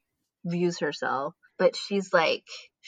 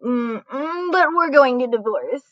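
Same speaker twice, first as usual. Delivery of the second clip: higher, louder, hammed-up stalling; hedging mock excuse; 5 semitones higher, 5 dB louder, slower than usual. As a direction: squirm through a put-on whiny excuse. Voicing married man's reluctant excuse.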